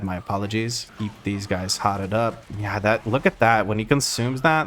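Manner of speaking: ordinary delivery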